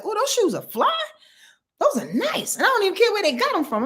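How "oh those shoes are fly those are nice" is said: complimenting a stranger. Retold or imitated. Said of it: "I'll be like".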